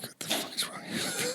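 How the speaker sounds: 숨죽인 목소리로 속삭이듯; whisper. Retold or imitated. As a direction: hiss it under your breath like a shocked wife. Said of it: "my wife was just like".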